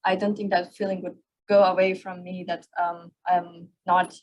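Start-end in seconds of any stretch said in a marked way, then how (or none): none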